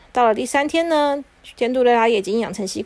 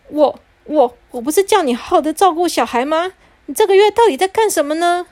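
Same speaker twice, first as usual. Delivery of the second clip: loud, higher scolding father; a husband back from abroad scolding hard; angry father; 5 semitones higher, 4 dB louder than usual. Acted. Father scolding his wife over the kids, loud and angry. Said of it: "进门的时候有点生气".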